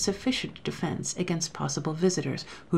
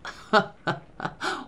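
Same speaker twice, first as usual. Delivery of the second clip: contemptuous laugh; 4 semitones higher than usual. A narrator's reading. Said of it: "a contemptuous laugh".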